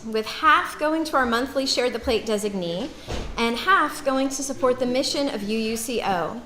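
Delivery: offering announcement. Acted. Speaking to the congregation at offering time, formal announcement. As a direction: invite the congregation to give, clearly and formally.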